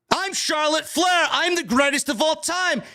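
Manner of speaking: a hint of a self-important champion; mock Charlotte Flair. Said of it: "It is the same gimmick".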